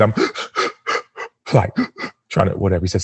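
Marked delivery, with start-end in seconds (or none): 0.2-1.2 gasping breath. Retold or imitated. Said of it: "Sam, I need you to breathe".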